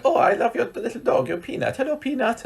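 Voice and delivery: high-pitched gushing over a little dog; cooing dog greeting. Retold or imitated. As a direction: put on a high, sugary hello to a pet. Retelling Alina cooing at a dog.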